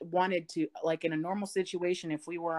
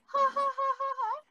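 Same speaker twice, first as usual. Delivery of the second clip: very high, silly imitation giggle; mocking the girl's laugh.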